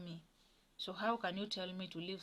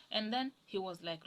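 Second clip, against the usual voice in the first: usual speaking voice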